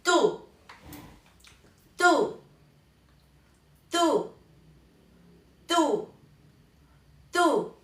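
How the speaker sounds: short TÚ, high and falling; Mandarin syllable demo. Shown as a demonstration. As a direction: say a crisp teacher's model TÚ with a long U. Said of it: "cómo la vamos a pronunciar". (tú en chino, agudo y descendente)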